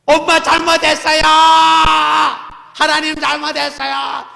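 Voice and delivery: high, loud wailing repentance; crying out. Retold or imitated. Child crying out to mom and God, high loud wail. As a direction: cry out like a sobbing child. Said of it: "이러면서 울었다는 거예요".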